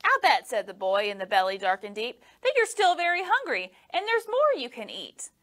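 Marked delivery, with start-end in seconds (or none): 0.0-0.5 put-on high, eager boy voice; bright little boy talking. A narrator's reading. Boy voice in story. 2.4-5.3 put-on high, coaxing boy voice; eager little boy coaxing. A narrator's reading. Boy voice in story.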